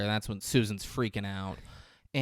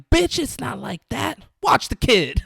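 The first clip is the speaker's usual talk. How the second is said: high loud mock gangster snap; mock tough guy; 14 semitones higher, 10 dB louder than usual. Retelling a movie, imitating the thug's high loud retort. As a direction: ham up the thug telling her off.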